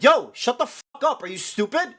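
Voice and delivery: loud, high-pitched angry yell; re-enacted furious telling-off; angry outburst. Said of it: "literally getting mad".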